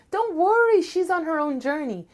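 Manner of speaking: reassuring sister. Acted. Venus tells him be patient.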